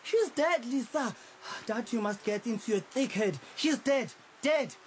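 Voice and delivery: high scornful laugh into a harsh insistence; mocking disbelief. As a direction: ridicule her, then hammer it home. Mocking her hope, child is dead.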